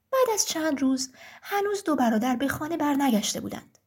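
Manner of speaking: ordinary delivery, nothing marked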